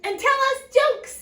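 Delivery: high, loud, quick request; funny dwarf. A narrator's reading. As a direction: pipe up fast and high. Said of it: "says the funny dwarf".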